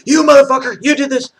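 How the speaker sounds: high, loud mock outburst; angry accusation. People turning on him for crossing the line, acted angry accusation. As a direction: imitate people blowing up in accusation. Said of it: "And you know, people".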